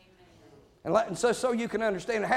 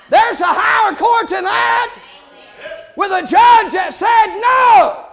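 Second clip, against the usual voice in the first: loud, higher shouted sermon, slowing on the key words; full-force preaching; 9 semitones higher, 11 dB louder than usual. Acted. Preacher shouting down excuses about the court, full-force preaching. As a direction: thunder it at the congregation.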